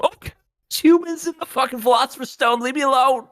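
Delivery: very high, loud mock cry; comic shrieked meltdown, fast; hammed-up wailing outburst. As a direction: blurt it out in a squealing, tearful panic. Acts out panicked outburst to Armstrong.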